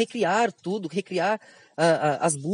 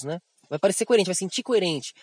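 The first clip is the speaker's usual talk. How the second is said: usual speaking voice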